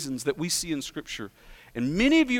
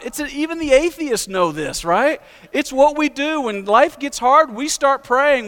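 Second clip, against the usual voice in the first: higher and louder, driving the point home; rising pulpit fervour, louder and higher; preaching at full force; 5 semitones higher, 6 dB louder than usual. Acted. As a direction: pound the truth into the congregation. Sermon climbs to full force.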